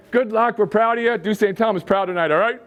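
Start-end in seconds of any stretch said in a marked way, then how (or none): none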